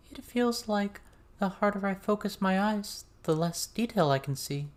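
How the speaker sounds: a hint of a shy girl puzzling it out; hesitant girl. A narrator's reading.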